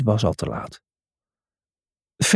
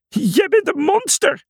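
shrieking. Acted. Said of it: "gilde Felix".

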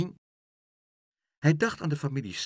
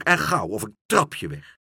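shouting; 4 semitones higher, 6 dB louder than usual. Acted. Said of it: "riep Pluk".

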